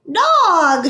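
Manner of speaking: high, loud squawk of a parrot; croaking parrot. A narrator's reading. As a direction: squawk it like a startled bird. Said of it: "she croaked".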